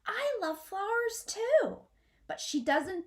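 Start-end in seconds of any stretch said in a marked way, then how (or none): 0.1-1.8 high, bright imagined cry from the treetop; high eager shout of joy; longing shout. A narrator's reading. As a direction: call it out high and eager, a little louder. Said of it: "wants to shout from the treetop".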